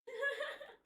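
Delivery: high rippling laugh; short burst of high laughter. Real, not acted. Laughs at own situation.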